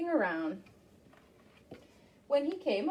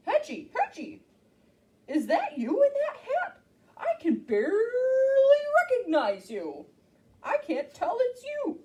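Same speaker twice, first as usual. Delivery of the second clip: dog voice; 11 semitones higher, 4 dB louder than usual. Acted. Dog speaks in story.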